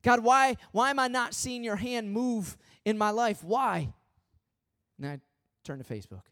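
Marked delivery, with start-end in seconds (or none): 0.0-3.9 loud, higher pleading why; a retold desperate prayer, loud; frustrated cry to God. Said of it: "God, why can't I hear you".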